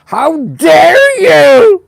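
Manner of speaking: Greta Thunberg. Retold or imitated. To the audience, mocking a climate scold with a high shrieking cry. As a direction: screech it like a furious scold. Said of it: "the horrible Greta Thunberg going".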